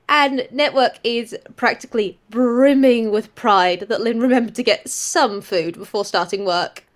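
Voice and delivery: ordinary talk, nothing marked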